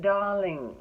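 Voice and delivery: playful imitation of posh British speech; posh British accent. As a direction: put on a posh British accent, stretching the word. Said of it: "her accent is posh and proper".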